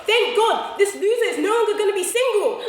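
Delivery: relieved and delighted; excited relief. Retold or imitated. Voiced inner relief.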